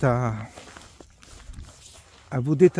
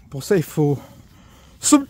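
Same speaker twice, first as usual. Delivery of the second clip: hiccup breaking the words; talking through hiccups; suppressing hiccups; 4 dB louder than usual. Real, not acted. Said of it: "j'ai le hoquet".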